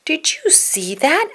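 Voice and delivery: high, slow amazed voice; Zoe asking. Acted. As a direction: ask high and slowly, amazed. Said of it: "asked Zoe".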